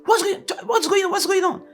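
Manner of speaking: a startled driver asking what happened; alarmed driver. Retold or imitated. Driver in the car hearing her scream, high alarmed asking.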